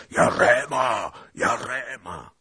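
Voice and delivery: high, drawn-out shouted name; long rallying shout, easing on the repeat; attackers' cry. A narrator's reading. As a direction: call it out as a war cry. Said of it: "górował krzyk napastników".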